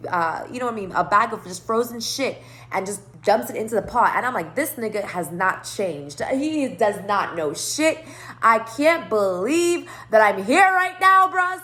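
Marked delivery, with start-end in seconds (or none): 4.6-11.6 can't-believe-this rant; exasperated disbelief. Retold or imitated. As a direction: stretch each word in mounting disbelief. Exasperated retold reaction, drawn out, louder.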